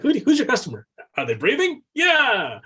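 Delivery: high, loud mock salesman, flippant; hammed-up high voice of a lazy marketer; mock clueless salesman. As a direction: toss it off like a careless seller. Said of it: "It's like".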